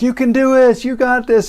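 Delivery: high encouraging cheer. Retold or imitated. Family cheering nervous son from below, high cheer. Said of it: "everybody's like".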